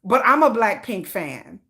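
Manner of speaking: deadpan low rebuttal; mock protest. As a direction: drop low and drag it. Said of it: "and I'm like".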